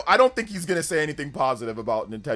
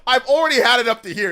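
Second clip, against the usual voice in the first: louder, fed-up outburst; fed up, push it out louder; exasperated; 7 semitones higher, 9 dB louder than usual. Real, not acted.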